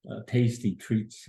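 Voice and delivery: usual speaking voice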